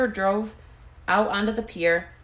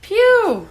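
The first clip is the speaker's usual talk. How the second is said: disgusted phew; 10 dB louder than usual. A narrator's reading. Bird reacts to bad smell.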